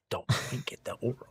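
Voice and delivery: fast whispered reproach; whispered scold. Acted. Old wife scolding her husband, whispered. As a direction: whisper it sharply. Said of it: "Mama says".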